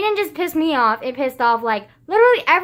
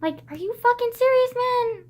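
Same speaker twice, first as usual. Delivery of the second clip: exasperated disbelief; 9 semitones higher than usual. Real, not acted. Exasperated outburst at classmate.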